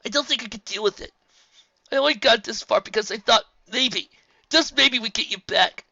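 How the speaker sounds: high, loud desperate plea; anguished. A narrator's reading. Spike pleading with Twilight, anguished. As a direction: pour it all out.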